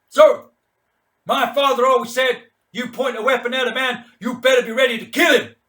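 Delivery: loud, high, firm warning; defiant officer. Acted. King warning Mad Mike about the pistol, firm loud warning. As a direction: stand your ground and warn him. King answers calmly yet firmly.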